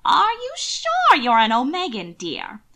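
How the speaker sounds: high, loud quavering aunt; doubting old aunt. Acted. Aunt Grace loudly questions Will, high pitched. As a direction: squint and doubt him aloud.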